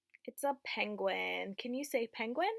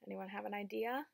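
ordinary delivery

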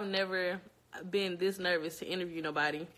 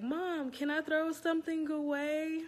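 put-on higher, slow little kid asking mom; lightly voiced small child; 8 semitones higher than usual. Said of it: "they're like".

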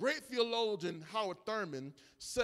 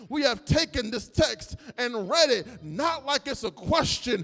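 louder, rhythmic preaching cries over the music; full-force preaching; 5 semitones higher, 10 dB louder than usual. Acted. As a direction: lift the church, call it out.

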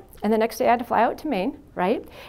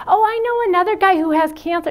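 mock eager interruption; 8 semitones higher, 6 dB louder than usual. Mimics someone butting in.

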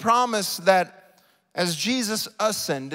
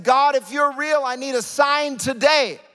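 raised, loud retold plea; crying out to God; 6 semitones higher, 6 dB louder than usual. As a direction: plead upward to God with a lifted voice. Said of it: "I cried out to God".

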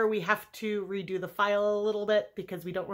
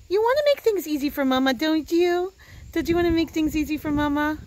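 higher, louder and fast sing-song dog talk; coaxing the dog; 8 semitones higher, 6 dB louder, faster than usual. Acted. An owner coaxing her barking dog Indy, high sing-song pet talk. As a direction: sweet-talk the puppy into behaving.

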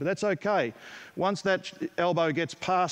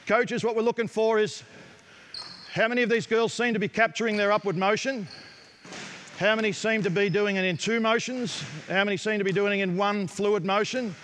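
raised, higher voice carrying over the court; projecting to the gym; 4 semitones higher than usual. Real, not acted. Coach calling to other coaches across a busy gym, raised projected voice. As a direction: call it out so the coaches hear over the balls.

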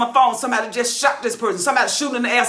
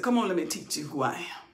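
low, soft voice of God answering; 10 semitones lower, 12 dB quieter than usual. Acted. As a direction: speak as God, low and hushed. God's voice answering his cry.

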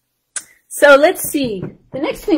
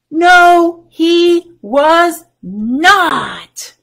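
loud, drawn-out NO, HE WAS NOT; emphatic refrain; 6 semitones higher, 6 dB louder, much slower than usual. Acted. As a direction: stretch each word of "No, he was not" high and loud. Storyteller's emphatic refrain answer.

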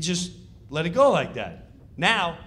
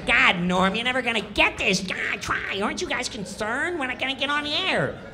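high, fast whiny heckle; Kattan's nagging; 7 semitones higher than usual. Retold or imitated. Kattan panicking at Norm before the sketch: high whiny nag. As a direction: nag him, high and quick. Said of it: "Kattan's going".

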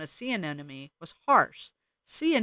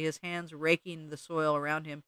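ordinary delivery

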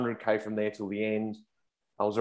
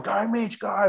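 high-pitched cheer of Mitch on; excited Aussie mate cheering; 10 semitones higher, slower than usual. Retold or imitated. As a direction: squeal it like a fan at the roadside. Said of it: "just to cheer me on".

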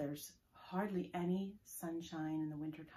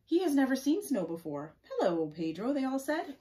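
story read-aloud; 9 dB louder than usual. Acted. Reading children's snow-angel chatter from a book, animated. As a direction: act out each child's line as you read.

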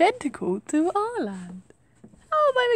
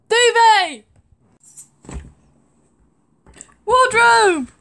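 shouted names; loud calling; 5 semitones higher, 5 dB louder, much slower than usual. Pretend. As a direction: call each word out loud. Calling out items in play.

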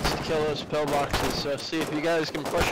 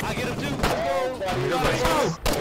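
excited cheer; 8 semitones higher than usual. Real, not acted. Cheering a kill in game.

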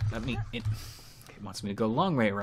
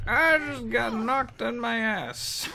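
squeaky voice; 12 semitones higher, 3 dB louder, much slower than usual. Pretend. Continues squeaky joke voice.

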